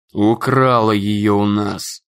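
low, slow growl. Acted. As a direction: growl it out in anger. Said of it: "прорычал Эйзенхауэр".

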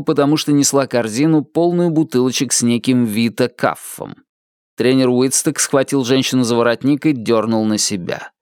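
usual speaking voice

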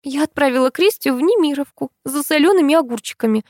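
high-pitched animated friend's voice; high, lively storytelling to a friend; Tamara explaining. A narrator's reading. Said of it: "пояснила Томочка".

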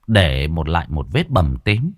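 plain reading voice

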